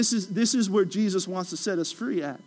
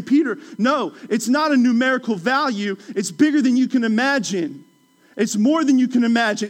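fervent Jesus; 5 semitones higher, 6 dB louder than usual. Retold or imitated. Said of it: "what Jesus was doing is saying".

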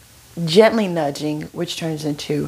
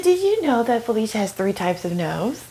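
imitated southern friend; 4 semitones higher than usual. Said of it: "here she goes".

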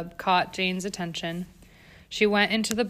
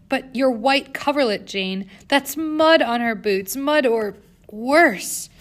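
aghast; 4 semitones higher, 5 dB louder, slower than usual. A narrator's reading. Governess aghast at mud.

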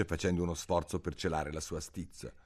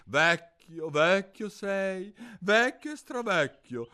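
higher, louder singsong taunt; mocking son; 13 semitones higher, 6 dB louder than usual. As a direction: jeer at the old man. Il figlio sbeffeggia il padre. (figlio che canzona il padre)

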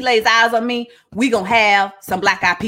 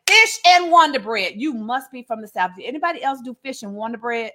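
ordinary delivery

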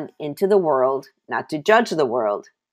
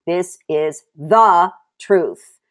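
loud, stretched "the" before "truth"; slow emphatic declaration with "the" punched; stress on THE; 6 dB louder, much slower than usual. Real, not acted. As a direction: hammer "the" slowly, then land on "truth". Preacher insists on the one truth.

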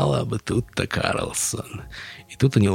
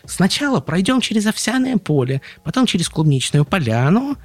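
high, eager chick voice; piping chick chatter; Tutta the chick; 10 semitones higher, 5 dB louder than usual. Acted. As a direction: explain the route like a chirpy little bird.

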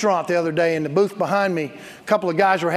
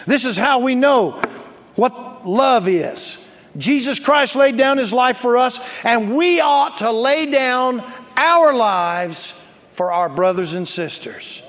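loud, raised verse with long stressed words; forceful scripture reading; 6 semitones higher, 6 dB louder, slower than usual. Acted. Pastor reading First John to the church, forceful preaching. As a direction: proclaim it, stretching the key words.

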